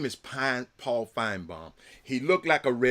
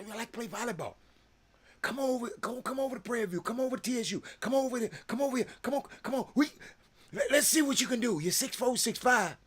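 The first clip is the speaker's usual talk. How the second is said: higher, eager, coaxing call; eager recruiter; 9 semitones higher than usual. Pretend. Calling a basketball player over to the volleyball team, eager. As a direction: sweet-talk her onto the team.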